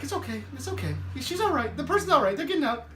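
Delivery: soft, high-pitched soothing; hushed insistence that all is fine; mock reassurance. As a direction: play down trouble in a small high voice. Voices Bibby playing down the crash.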